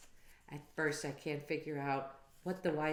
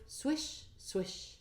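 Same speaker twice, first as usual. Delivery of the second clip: swishing leaves; 8 semitones higher than usual. Acted. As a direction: let the swish drift away. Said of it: "leaves fell on the roof".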